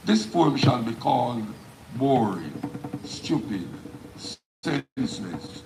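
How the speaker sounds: emphatic spoken-word delivery, phrases falling at the ends; poem recitation. Acted. Poet performing the poem live for an audience, declaimed. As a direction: declaim each line with weight, pausing between phrases.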